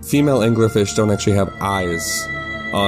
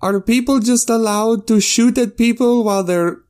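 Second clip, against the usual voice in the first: high, laughing, innocent question; high character voice; 11 semitones higher than usual. Acted. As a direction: ask it sweetly, voice pitched way up. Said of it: "he says".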